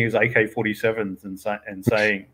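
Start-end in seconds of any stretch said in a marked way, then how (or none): none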